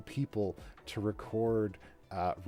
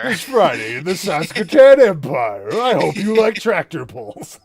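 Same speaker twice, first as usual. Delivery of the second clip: booming epic trailer announcer, louder; movie trailer voice; 7 semitones higher, 15 dB louder than usual. Acted. Takes over the trailer voice.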